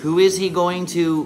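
normal talking voice

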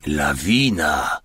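low, slow, drawn-out amazement; understated astonished call. A narrator's reading. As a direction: let the word stretch out in disbelief. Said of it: "Zawołał zdumiony stary".

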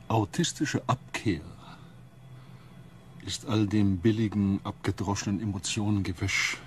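measured contempt with long pauses; cold disdain. Acted. Osten entering and scorning the crew's emotional chatter, cold disdain.

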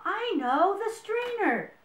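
high, excited exclamation; excited idea. A narrator's reading. Friend thinks of a way to save Max, excited. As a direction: announce a sudden bright idea.

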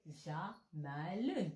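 slowly drawn classical word; slow classical Arabic. Shown as a demonstration. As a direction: mouth it slowly, formal. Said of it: "حنا بالفصحى".

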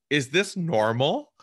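a touch of astonishment, drawn out; mock disbelief. Asking veteran agents in disbelief.

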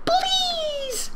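very high wailing plea with a laugh; mock desperate cry. Girl begging the kidnapper, hammed-up high cry. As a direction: wail it, half laughing. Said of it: "I cried".

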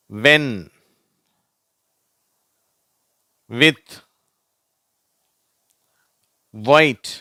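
வேல், வாட், வென், வித், ஒயிட் singly, long pauses, no h; silent h. Shown as a demonstration. Teacher reading WH words as models, h silent. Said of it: "இவ் என்ற உச்சரிப்பு வருது".

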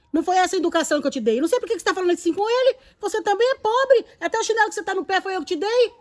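mother rebuking Charlene before a stranger, loud and fast; scolding mother. Acted. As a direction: scold your daughter in front of him. Mother scolds rude daughter.

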